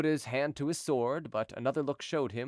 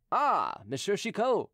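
higher-pitched cry of recognition; exclaiming; 7 semitones higher, slower than usual. A narrator's reading. Officer at the window recognizing Chicot, crying out. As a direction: call out on recognizing a visitor. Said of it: "cried the officer".

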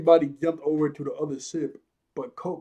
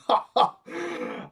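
gloating mock laugh; 8 semitones higher than usual. Captain gloating at the compliment, short mock cackle. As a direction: cackle as the vain villainess. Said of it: "she starts grinning".

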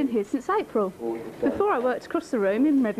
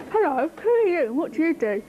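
higher, sing-song frog; joke frog voice; 6 semitones higher than usual. Acted. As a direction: play the inquisitive frog greeting a stranger. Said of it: "he goes up to the crocodile and he goes".

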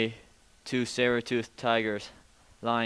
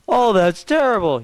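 shocked; 11 semitones higher, 9 dB louder than usual. Acted. Shocked tiger hearing of killing.

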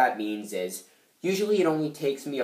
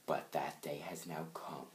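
hushed, low mysterious whisper; 8 semitones lower, 15 dB quieter than usual. Acted. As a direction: whisper it like a dark secret being revealed. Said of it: "so mysterious".

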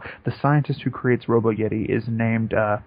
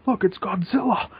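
high-pitched imitated onlooker; mock alarmed cry; 8 semitones higher than usual. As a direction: cry out in pretend surprise, pitched way up. Voices onlookers spotting Godzilla.

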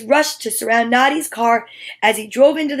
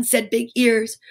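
usual speaking voice

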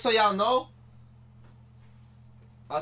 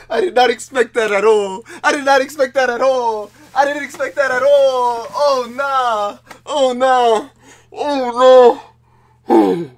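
loud and high, still reeling; overwhelmed; 5 semitones higher, 11 dB louder, slower than usual. Real, not acted. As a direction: shout it in disbelief. Overwhelmed after big pull.

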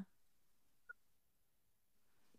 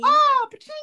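much higher, much louder cash-register cheer; pa-ching cry; 11 dB louder than usual. Acted. Celebrating a win to her friend with a loud high pa-ching. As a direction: ring it out like a jackpot.

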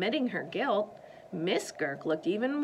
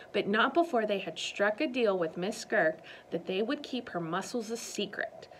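usual speaking voice

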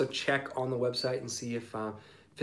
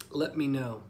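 normal talking voice

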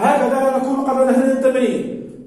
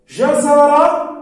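slow, louder and higher; drawn-out word; 4 semitones higher, 5 dB louder, much slower than usual. Shown as a demonstration. Teacher dictating جزر, slow and loud. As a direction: stretch جزر out clearly. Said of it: "ما هي التاء التي سأكتبها هنا".

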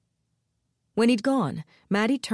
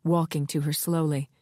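usual speaking voice

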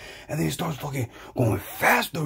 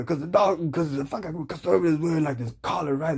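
ordinary talk

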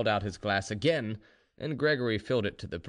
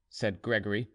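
ordinary delivery, nothing marked

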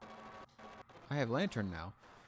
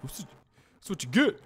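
gloating, bigger; louder taunt; 6 semitones higher, 7 dB louder than usual. Real, not acted. Streamer gloating at the soldier, louder and higher. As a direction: punch it up loud and high.

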